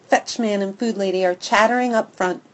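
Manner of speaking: plain reading voice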